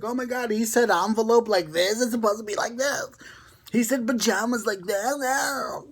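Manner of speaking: high, squealing mock-outraged viewer; mock shocked viewer. Mocking viewers who gasp at how he says words, high and squealy. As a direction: ham up a shocked listener mocking his accent.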